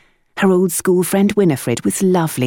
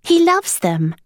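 put-on high boy's voice with a grin; pleased with himself; much higher child's voice; grinning boy; slower than usual. A narrator's reading. Boy about his frog.

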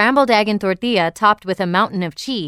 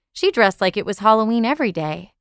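usual speaking voice

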